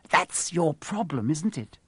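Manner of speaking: slow, gleeful taunt; cracking with glee. A narrator's reading. Caretaker taunting a frightened pupil, gleeful. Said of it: "his voice cracking with glee".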